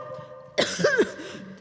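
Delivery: harsh high-pitched coughing fit; helpless hacking cough; choking cough. Acted. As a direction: cough hard, fighting for breath. Announcer overcome by coughing on air.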